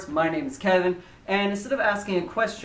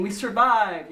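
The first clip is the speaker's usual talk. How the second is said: higher, playful cheer of relief; mock triumph; 5 semitones higher, 4 dB louder, slower than usual. Wrestlers high-five everyone as the ride ends, mock triumph. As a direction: celebrate a pretend narrow escape. Acts out riders celebrating after ride.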